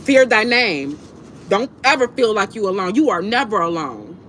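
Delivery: normal talking voice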